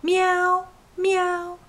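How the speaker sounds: high playful meow; cat meow. Shown as a demonstration. Mom answering baby's meow, high kitten meows. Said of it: "Can you say meow?".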